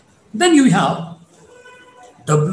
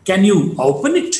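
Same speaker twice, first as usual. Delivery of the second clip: "it" lifted at the end; rising tone. Shown as a demonstration. Teacher shows where to mark it, rising tone. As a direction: ask it with "it" going up. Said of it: "marked with the rising tone".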